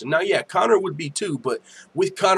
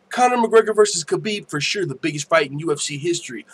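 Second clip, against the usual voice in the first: usual speaking voice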